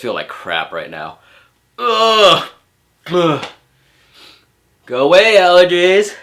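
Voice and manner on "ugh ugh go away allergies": loud, high groan telling the allergies off; fed-up groan. Real, not acted. Vlogger cursing his allergies, loud fed-up groan. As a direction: shout at your own sneezes in exasperation.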